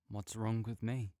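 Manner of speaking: ordinary talk, nothing marked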